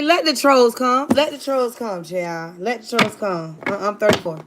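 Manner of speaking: normal talking voice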